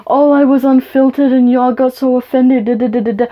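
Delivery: sarcastic imitation of a dismissive man; mocking Farhan. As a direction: parrot him back in a snide, whiny voice. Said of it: "he was like".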